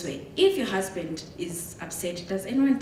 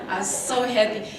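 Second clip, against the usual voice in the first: usual speaking voice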